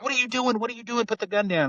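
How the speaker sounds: urgent high-pitched imitation of the cop; retold alarmed pleading. Cop to girl with a gun, retold alarm.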